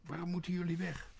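high, loud demanding question; indignant high-pitched call. A narrator's reading. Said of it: "riep Quark naar de kraai".

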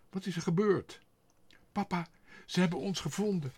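high, loud, urgent; alarmed. A narrator's reading. Father and child after her flight, alarmed. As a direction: blurt it out in alarm.

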